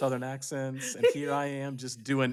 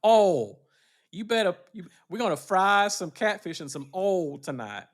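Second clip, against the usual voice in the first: louder, higher, slow Southern drawl; mock Southern accent; 5 semitones higher, 8 dB louder than usual. Host riffing on a Southern supper, loud mock Southern drawl. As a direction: call it out like a Southern auntie.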